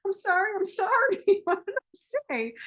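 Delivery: high, loud flustered apology; hammed-up panicked clerk. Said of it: "She's like".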